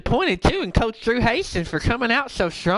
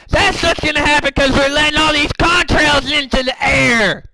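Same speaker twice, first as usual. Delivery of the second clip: higher and louder, a runaway conspiracy tirade; frantic rant; 3 semitones higher, 4 dB louder than usual. Acted. Radio host ranting wildly to his listeners about contrails. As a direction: whip yourself up into a paranoid frenzy.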